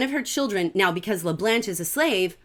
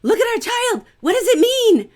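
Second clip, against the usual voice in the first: high, loud frantic plea; panicked wife begging her husband; frantic pleading cry; freaking out; 14 semitones higher, 7 dB louder than usual. Retold or imitated. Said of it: "She's freaking out".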